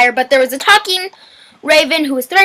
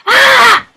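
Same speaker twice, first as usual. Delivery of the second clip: anguished scream; 8 semitones higher, 6 dB louder than usual. Acted. Character screams at shirt.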